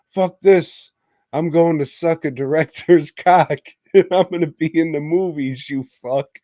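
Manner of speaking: hammed-up fed-up wife; mock angry retort. Wife retorts to her husband.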